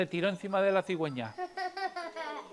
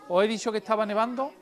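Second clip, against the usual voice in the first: ordinary delivery, nothing marked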